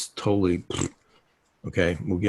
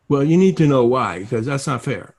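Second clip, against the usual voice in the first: raised, higher voice of an arguing partner; demanding objection; 5 semitones higher, 6 dB louder than usual. Retold or imitated. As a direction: push back loudly, imitating a pushy partner. Acted quarrel over the party.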